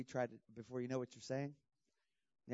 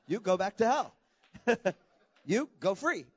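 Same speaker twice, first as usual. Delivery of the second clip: commanding; 7 semitones higher, 10 dB louder than usual. Retold or imitated. Demons cast out, laughing retelling.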